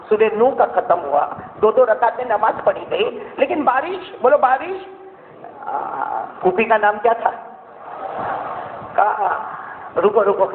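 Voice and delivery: preacher telling a miracle story to the crowd; impassioned sermon narration; sermon storytelling. Acted. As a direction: tell it like a fervent preacher.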